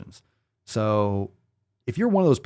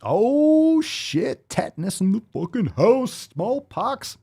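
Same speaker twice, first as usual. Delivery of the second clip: mock hype cheer; 12 semitones higher, slower than usual. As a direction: pump up the crowd like a hype man. Cheering vaccine pride jokingly.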